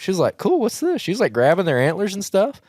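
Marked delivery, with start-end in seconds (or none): 0.4-1.0 put-on high-pitched little-kid voice; imitated curious child. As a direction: mimic a child happily checking something out. Voicing curious child last year.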